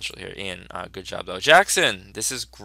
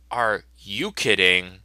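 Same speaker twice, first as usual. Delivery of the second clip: slow drawn-out disbelief; 5 dB louder, slower than usual. Acted. Comic character repeats it slowly in disbelief. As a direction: stretch it out in disbelief.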